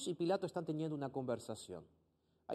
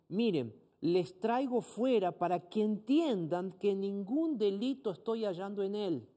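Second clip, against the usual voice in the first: raised, slow, lightly voiced Pilate; a hint of Pilate, addressing the crowd; 5 semitones higher, 3 dB louder, slower than usual. Pastor reads Pilate to the crowd, lightly voiced and raised. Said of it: "él les dice así".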